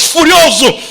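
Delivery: high, emphatic preaching; heated. Acted. Preacher stirring the congregation, high and fervent. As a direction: push the word up with passion.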